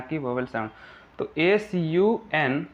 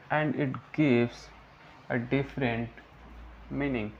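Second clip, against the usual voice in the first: very slow, broken-up definition reading; slow dictation; much slower than usual. Shown as a demonstration. Teacher reads the prefix definition for students, slow dictation. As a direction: dictate phrase by phrase with long pauses.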